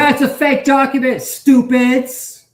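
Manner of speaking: loud, high scoffing outburst; shouted interjection. Real, not acted. Reacting to a fake document, loud scorn. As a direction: call it out loudly over the video. Shouts over the video he watches.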